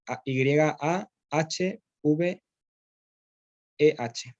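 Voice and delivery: spelled letter by letter, slowly; spelling out letters. Shown as a demonstration. Teacher spelling Yahvé for a student, letter by letter. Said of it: "Yahvé, ¿cómo se llama?".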